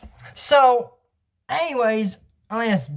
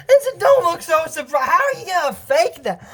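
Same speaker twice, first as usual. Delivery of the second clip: excited outburst; 8 semitones higher than usual. Real, not acted.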